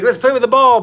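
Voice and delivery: loud, high eager dog voice; Cruz. Retold or imitated. Dog inviting dad to play ball, excited imitation. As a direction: exclaim it as an excited playmate. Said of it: "Cruz is like".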